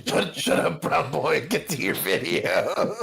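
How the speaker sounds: high, laughing mock telling-off; laughing mock scolding. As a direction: scold playfully through laughter. Jokingly scolds a chatter while laughing.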